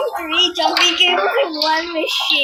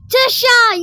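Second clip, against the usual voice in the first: startled; 8 semitones higher, 9 dB louder than usual. Real, not acted. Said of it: "you scared me".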